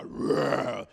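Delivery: loud mock lion roar; imitating a lion's roar. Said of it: "if you heard a lion go".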